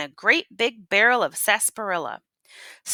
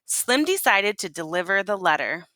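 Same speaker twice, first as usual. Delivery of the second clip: plain reading voice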